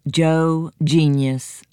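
plain reading voice

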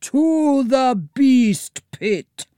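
high, drawn-out villainous yell; villain's yell. A narrator's reading. Lord of thieves to his minions, yelled command. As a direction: bellow the order slowly to the horde. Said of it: "yelled the Lord of Thieves".

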